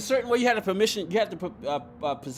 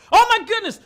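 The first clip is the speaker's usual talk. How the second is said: loud, high exclamation of mock shock; mock dismay; 11 semitones higher, 9 dB louder than usual. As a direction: exclaim in playful horror. Acts out horrified reaction to accent.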